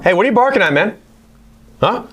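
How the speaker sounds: high, sing-song voice for the dog; talking to the dog. Real, not acted. Teacher calls out to the barking dog in a high voice.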